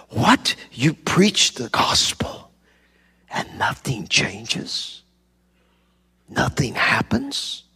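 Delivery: imitated South African disbelief, breathy near-whisper; slow, hushed question in a lightly imitated South African accent. Young pastor stunned nothing changes, imitated South African accent. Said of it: "in his South African accent".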